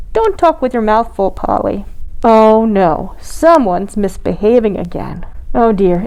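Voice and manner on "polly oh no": usual speaking voice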